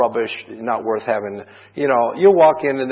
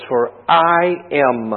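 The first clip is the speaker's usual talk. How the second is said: For I am, drawn out slow and a little louder; slow, weighty I am; stress on I am; 3 dB louder, much slower than usual. Shown as a demonstration. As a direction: lean hard on I am. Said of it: "two words that are emphasized".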